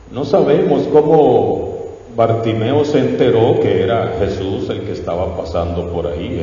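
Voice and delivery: ordinary delivery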